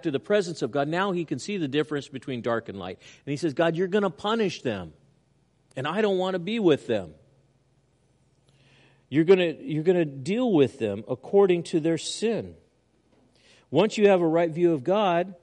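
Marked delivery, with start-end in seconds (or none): 3.5-12.6 raised psalmist's plea. Acted. Preacher voicing psalmist's prayer.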